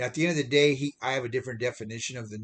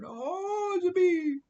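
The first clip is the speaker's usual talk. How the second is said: very high, squeaky mock-scared voice; mock frightened squeal; 6 dB louder, much slower than usual. Believers scared of a bee buzzing round their face, high squeal. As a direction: shriek it up high like someone startled by a bee.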